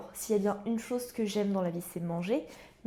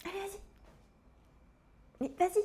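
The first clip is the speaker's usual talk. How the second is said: high coaxing voice; sing-song encouragement for a pet; 11 semitones higher, much faster than usual. Real, not acted. Urging the pet on.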